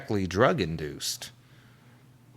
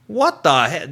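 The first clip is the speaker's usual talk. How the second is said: louder, raised mock fury; imitated outrage; 5 semitones higher, 8 dB louder than usual. Oprah backstage after the interview, mock angry rant. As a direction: vent like a furious host backstage. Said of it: "Oprah Winfrey went backstage and was like".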